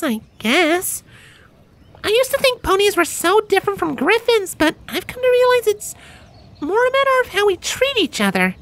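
put-on very high young griffin voice, earnest; a cheerful griffin sharing a life lesson; squeaky-high, sincere and sunny; Gabby, thoughtful griffin.

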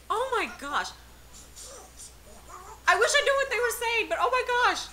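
high-pitched, thrilled reaction; excited. Real, not acted. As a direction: gush in real amazement, pitch up. Thrilled live reaction to game trailer.

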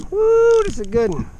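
normal talking voice

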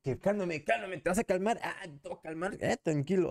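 burla ligera de dos machitos peleando, un poco más agudo; imitando a un machito. Retold or imitated. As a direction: remeda con sorna el pleito de machitos. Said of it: "según ellos, bien machitos". (imitación burlona de la pelea de Rome y Lupillo)

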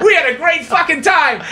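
high, elated group cheer, acted out; mock cheering crowd. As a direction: imitate a thrilled table, laughing along. Table cheers after heckler leaves.

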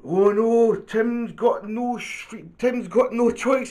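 high, loud mock dismay; storybook shout. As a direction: raise the alarm as in a picture book. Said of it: "he shouts".

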